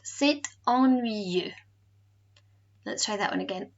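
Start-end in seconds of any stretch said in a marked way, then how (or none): 0.1-1.5 very slow "C'est ennuyeux", drawn-out eu ending; eu sound. Shown as a demonstration. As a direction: model C'est ennuyeux slowly, syllable by syllable. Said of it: "gives us that same E sound".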